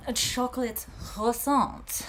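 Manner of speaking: high, slow French chef voice; mock French chef accent. Reader ordering pastry in a mock French accent. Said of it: "My French accent comes from the chef".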